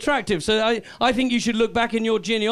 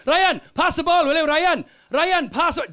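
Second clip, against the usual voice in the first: pleading call on the pitch; 7 semitones higher, slower than usual. Retold or imitated. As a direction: yell for the ball across the pitch. Teammates begging Ryan on pitch.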